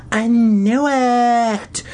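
high, very drawn-out croak; hoarse croak. A narrator's reading. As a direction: stretch it into a triumphant croak. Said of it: "Judith croaked in her hoarse voice".

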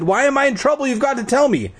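high, urgent demand; insistent. A narrator's reading. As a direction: push for an answer, pitch way up. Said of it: "But Iris, I insisted".

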